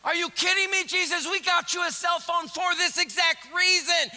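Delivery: comic loud pestering; frantic friends. Acted. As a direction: whine at him like a worried buddy. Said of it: "continued friends".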